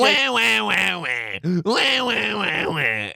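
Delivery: put-on high wailing wah wah, like a bawling toddler; mock the pastor's tantrum with high baby wails; mock baby crying. Mocking the angry pastor as a crying baby, high mock wails.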